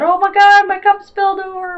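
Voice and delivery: hammed-up despair on one high held pitch; mock sad wail. Card figure crying over her spilled cup, mock sad wail. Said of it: "she's all sad".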